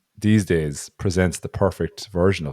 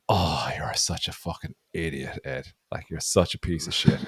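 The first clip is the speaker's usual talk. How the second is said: muttered self-insult; 4 semitones lower, 7 dB quieter than usual. Retold or imitated. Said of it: "I was behind him going".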